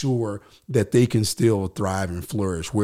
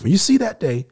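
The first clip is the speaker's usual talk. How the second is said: raised, higher declaration; emphatic preaching; 8 semitones higher, 5 dB louder, faster than usual. Real, not acted. Speaking to the podcast hosts, a louder, higher preaching call. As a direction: proclaim it like a sermon. Preaching the jubilee commands, rising.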